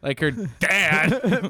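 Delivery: high, slow, laughing repeat; laughing echo. Retold or imitated. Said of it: "What the fuck?".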